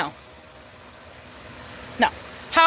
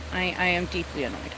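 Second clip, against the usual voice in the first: quiet mutter; 7 semitones lower, 9 dB quieter than usual. Real, not acted. Repeating her annoyance.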